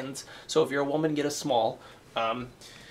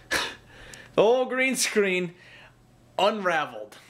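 dismayed; 5 semitones higher, 4 dB louder, slower than usual. Real, not acted. Dismayed at unravelled screen.

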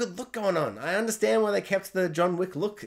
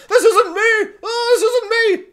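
much higher, louder, laughing falsetto panic; mock panicked squeal; 10 dB louder, slower than usual. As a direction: squeal like a flustered hero mid-air. Mocking Neo while flying.

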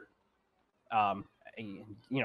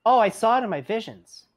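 put-on high, loud little-girl voice; matter-of-fact child seer; Evandra; 8 semitones higher, 5 dB louder than usual. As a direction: chirp it proudly, pitched high. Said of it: "she kinda goes".

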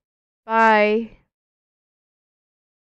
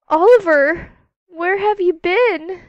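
surprised gasp; 7 semitones higher, 4 dB louder than usual. Pretend. Mother finds missing Oliver.

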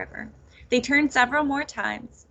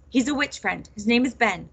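ordinary delivery

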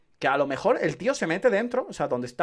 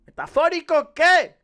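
exasperated shout; 11 semitones higher, 8 dB louder, slower than usual. Real, not acted. Exasperated at pretentious dialogue.

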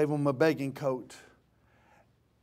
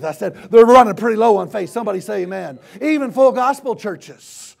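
raised, loud pulpit cry; fired-up preacher pressing the congregation; forceful preaching; 8 semitones higher, 14 dB louder than usual. Real, not acted. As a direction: drive each point home at full force.

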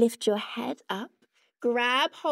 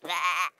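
lower, disgusted bleh for the teddy; bear's bleh; 5 semitones lower than usual. Pretend. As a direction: voice the bear refusing the slime. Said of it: "Bear doesn't like slimy sandwiches".

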